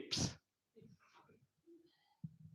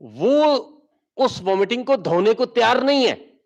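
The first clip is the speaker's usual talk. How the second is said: excited; 7 semitones higher, 3 dB louder than usual. Real, not acted. Comic exaggeration about the victim.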